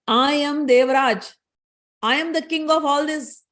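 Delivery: boastful king. Acted. Indra boasting to Vishnu about his power, proud and high. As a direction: puff up like the king of heaven.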